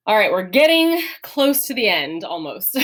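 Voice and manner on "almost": normal talking voice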